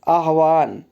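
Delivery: ordinary delivery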